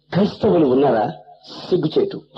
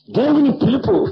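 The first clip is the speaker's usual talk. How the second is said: higher, slow preaching with rising fervor; slow, fervent sermon appeal, pitched higher; 8 semitones higher than usual. Acted. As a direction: call out to the congregation slowly and higher. Preaching climax.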